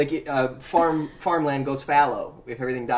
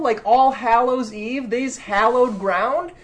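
high, slow, emphatic challenge; indignant protest; 9 semitones higher, 6 dB louder than usual. Real, not acted. Challenging co-host the teacher, high indignant protest. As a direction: draw out each example in loud disbelief. Heated mock quarrel over pronunciation.

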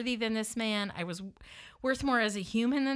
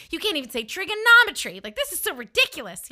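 high, loud mock exasperation; scornful name-calling; 7 semitones higher, 9 dB louder than usual. Woman mocking her date's mistake, exasperated. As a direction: pounce on the word in indignant disbelief. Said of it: "I start calling him like".

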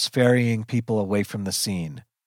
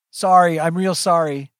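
raised, louder apology; blurted apology; 7 semitones higher, 5 dB louder than usual. A narrator's reading. Son apologises to his mother, blurting it out high. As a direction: apologise out loud in a higher voice.

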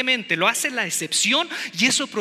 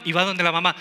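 normal talking voice

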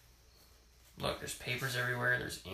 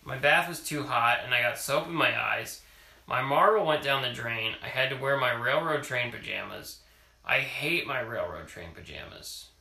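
reading a picture book aloud; story reading; 7 dB louder than usual. Acted. Parent reading a picture book to child, storytelling voice. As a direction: read it to a child at bedtime.

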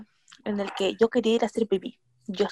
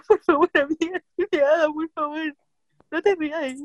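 pleading; 6 semitones higher than usual. Retold or imitated. Pleading with laughing friend.

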